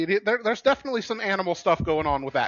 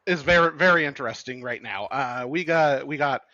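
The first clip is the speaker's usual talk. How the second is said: ordinary talk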